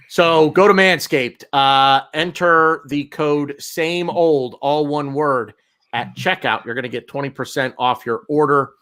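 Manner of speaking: ordinary delivery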